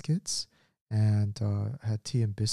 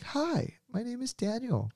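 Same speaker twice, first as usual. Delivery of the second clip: high, bright-toned polite greeting to a stranger; salesman-sweet greeting to an old lady, pitched high; friendly voice to strangers; 12 semitones higher, 4 dB quieter than usual. Retold or imitated. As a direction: sound eager and harmless at the door. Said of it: "my friendly voice to strangers".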